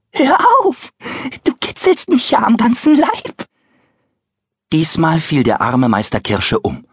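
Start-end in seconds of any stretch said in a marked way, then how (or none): 0.1-3.5 very high, louder, giggling little voice; tickled tiny voice. Acted. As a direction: shriek it while being tickled. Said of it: "das ihm lachend zurief".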